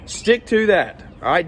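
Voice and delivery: high, punchy and insistent; fired up. Real, not acted. Owner warning small landscapers to keep promises, fired up and high. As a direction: still fired up, stab the point in. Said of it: "I know I'm getting fired up".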